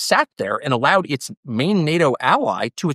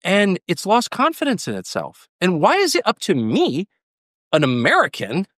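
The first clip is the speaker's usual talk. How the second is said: usual speaking voice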